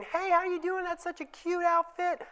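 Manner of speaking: put-on chirpy baby-talk hello that tries way too hard; too strong. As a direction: overdo the cheery welcome to a wary kid. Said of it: "if you go in there too strong".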